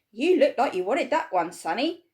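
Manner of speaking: put-on a cheery shopkeeper teasing the boy; pleasant shopkeeper. A narrator's reading. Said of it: "the shopkeeper said pleasantly".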